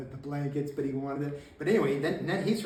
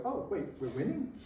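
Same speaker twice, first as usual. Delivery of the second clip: higher, softly puzzled question; surprised doubt; 5 semitones higher, 3 dB quieter than usual. Retold or imitated. His guys hear the enemy is running, mock surprise. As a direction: act as if it just dawns on you.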